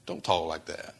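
low, soft voice for Peter's protest; Peter objecting. Retold or imitated. Peter stops Jesus talking of death, low and soft. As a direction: Peter hushing Jesus, dropping to a low murmur. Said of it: "Peter steps up and he goes".

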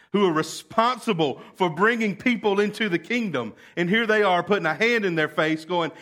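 loud, slowed impassioned sermon; preacher building to full force; fiery preaching. Acted. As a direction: preach it hard to the congregation.

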